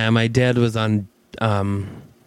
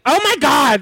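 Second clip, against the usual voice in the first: much higher, louder alarmed cry; horrified shriek; 13 semitones higher, 5 dB louder than usual. Retold or imitated. Hearing Dante crack her jaw, shrieking in alarm. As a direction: yelp as if he broke her jaw. Jaw snapped, thought something bad.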